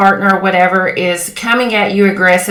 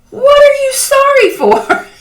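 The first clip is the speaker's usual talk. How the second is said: high-pitched exasperated outburst; exasperated at a friend, voice leaping way up; frustrated. Retold or imitated. Asking an over-apologizing girlfriend what for, frustrated. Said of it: "I would get so frustrated with her".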